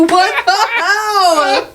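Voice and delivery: high, slow, laughing cry of disbelief. Retold or imitated. Wife retelling her outrage at her husband, laughing high wail. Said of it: "I go".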